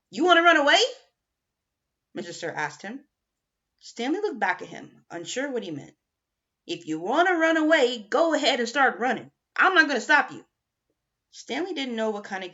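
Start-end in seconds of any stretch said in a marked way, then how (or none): 0.1-0.8 high, loud taunting question; mocking challenge. Mister Sir taunts Stanley, high and loud. 6.7-10.5 higher, louder scornful dare; mocking dare. Mister Sir goads Stanley to flee, loud sneering dare.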